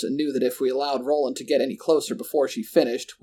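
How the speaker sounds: plain reading voice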